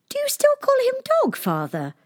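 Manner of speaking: very high, quick eager princess; cartoon princess. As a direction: ask brightly like a little girl. Princess asks the king.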